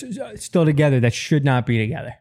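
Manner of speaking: ordinary talk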